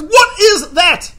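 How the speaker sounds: high, loud and slow, every word weighed; accusing waiter at the fish tank; loud slow demand. Retold or imitated. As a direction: make him look at the tank.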